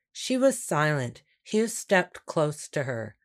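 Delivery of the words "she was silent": plain reading voice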